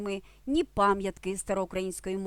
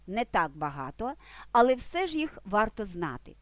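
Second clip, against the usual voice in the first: ordinary talk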